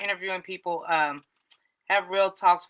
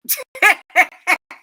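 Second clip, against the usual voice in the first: loud high laugh; 12 semitones higher, 9 dB louder than usual. Real, not acted. Big laugh after joke.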